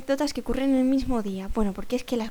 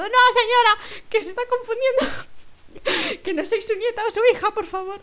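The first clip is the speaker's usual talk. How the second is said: high, whining mock despair; comic protest; 9 semitones higher, 3 dB louder than usual. Girl to confused older woman, whining panicked protest. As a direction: plead shrilly as if near mock tears.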